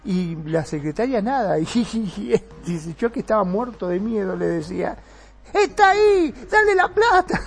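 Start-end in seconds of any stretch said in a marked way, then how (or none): 5.5-7.4 high, loud frantic yell at the secretary; desperate shout to hand over the money; panicked yelling. Retold or imitated. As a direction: scream it, terrified for your life. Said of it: "Y le gritaba".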